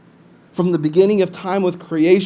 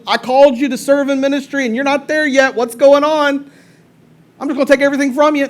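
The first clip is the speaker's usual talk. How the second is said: raised, louder scolding, hammed-up; mock demanding God; 6 semitones higher, 5 dB louder than usual. Preacher mimicking a God who takes back blessings, mock scolding. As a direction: play an indignant, threatening rant in mockery.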